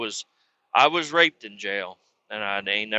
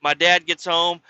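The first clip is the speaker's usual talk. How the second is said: ordinary talk, nothing marked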